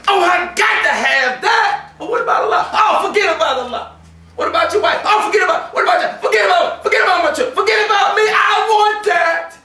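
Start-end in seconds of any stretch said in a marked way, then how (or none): 0.1-1.9 high, slow, shouted greed; craving. Acted. Tempted person craving aloud. 2.7-9.6 the tempted soul shouting past God and family, greedy; reckless craving. Acted. Tempted person dismissing everything.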